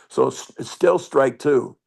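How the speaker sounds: normal talking voice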